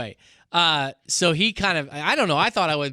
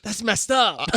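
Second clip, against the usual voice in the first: high, louder, offended dude; shocked inmate; 8 semitones higher, 5 dB louder, slower than usual. Retold or imitated. Inmate reacting to the cave story, high and appalled. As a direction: sound genuinely appalled.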